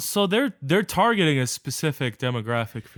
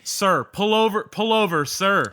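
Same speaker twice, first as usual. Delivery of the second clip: raised, louder pretend cop command; mock police order; 6 semitones higher, 4 dB louder than usual. Co-host playing scooter canvasser as police, loud mock order. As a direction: order him over like a pursuing officer.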